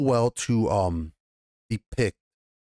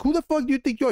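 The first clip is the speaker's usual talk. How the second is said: much higher and fast mock fury; indignant outburst; 13 semitones higher, much faster than usual. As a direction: spit it out fast, pitch leaping up. Quoting Rhaenyra's defiance.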